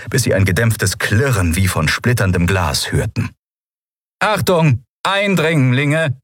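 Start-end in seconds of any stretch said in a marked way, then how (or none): 4.2-6.2 high-pitched robotic warning, drawn out at the end; stiff, high automated alert; rasping machine alarm. A narrator's reading. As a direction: announce it like a security machine. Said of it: "schnarrte die Kugel".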